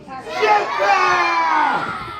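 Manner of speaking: long stretched high shout to lead the room; crowd shout. Shown as a demonstration. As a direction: yell it out slow and big with the crowd. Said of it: "on three, we're gonna say jetpack".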